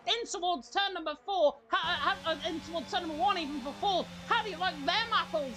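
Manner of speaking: high, excited race call, voice cracking; voice cracking excitement. Real, not acted. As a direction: yell the overtake, pitch way up. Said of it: "my big voice cracks in that ending".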